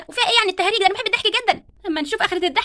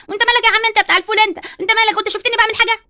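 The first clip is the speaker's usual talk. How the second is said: louder, faster, indignant; angry retort; 3 semitones higher, 5 dB louder, faster than usual. Acted. Barbie rounds on the bean seller, loud and fast. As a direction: snap back at the bean seller.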